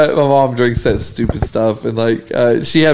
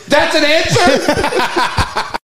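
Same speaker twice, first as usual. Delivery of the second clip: much higher, very slow, laughing disbelief; incredulous dad; much slower than usual. Retold or imitated. Dad asks the drive-thru in disbelief, high and laughing. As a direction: stretch it out, cracking up.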